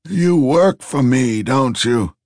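put-on hunched old man pressing slowly. A narrator's reading. Old Truss voiced.

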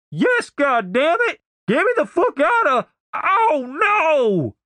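very high, panicked holler; frantic high-pitched yelling; desperate cry. Acted. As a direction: plead to be freed, voice shooting way up. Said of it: "Denise's voice sounded desperate".

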